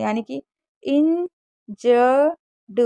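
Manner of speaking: इंजर्ड stretched very slowly; slow teaching demonstration; drawn-out model word. Shown as a demonstration. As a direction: hold out इंजर्ड, the ending sounded as डा. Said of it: "ईडी को डा पढ़ते हैं".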